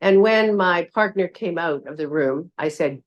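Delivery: ordinary delivery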